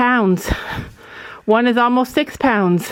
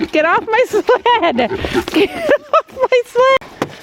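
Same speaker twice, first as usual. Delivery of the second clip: high squeal through laughter, drawn out; laughing mock scolding; 13 semitones higher, slower than usual. Real, not acted. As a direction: playfully shoo them off, rising into a squeal. Laughing scolding of animals on sled.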